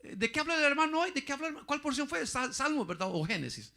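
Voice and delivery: high, chattering mock gossip; lightly voiced busybody; gossiping churchgoer. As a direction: play the nosy sister asking around. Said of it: "sale y dice".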